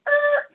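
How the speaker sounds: high record-scratch "er"; sudden high-pitched brake sound; screech-to-a-halt noise. Retold or imitated. Storyteller undercutting the romantic eyes moment, comic screech.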